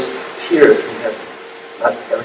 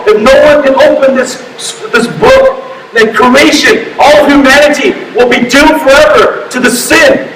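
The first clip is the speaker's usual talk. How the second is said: high-pitched, urgent sermon delivery; emphatic preacher's cry, pitched up; impassioned preaching; 8 semitones higher than usual. Real, not acted. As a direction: preach it forcefully, warning of doom. Preaches at full force.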